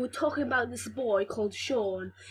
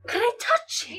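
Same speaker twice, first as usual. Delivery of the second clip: put-on much higher cheeky boy voice; squeaky Sean voice; 12 semitones higher than usual. Kid retelling Sean's pickup line, squeaky high.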